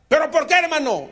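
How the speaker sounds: high, loud shocked plea; high protest. Acted. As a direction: cry out in disbelief. Chanito protests.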